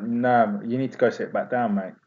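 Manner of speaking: quiet warning. Retold or imitated. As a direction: tell him off quietly but firmly. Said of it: "I was like".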